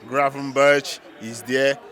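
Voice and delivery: ordinary talk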